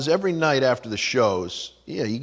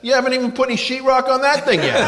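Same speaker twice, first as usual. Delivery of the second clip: much higher, louder mocking retort; 13 semitones higher, 5 dB louder than usual. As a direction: shout the jab at the waiter. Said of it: "I'm going".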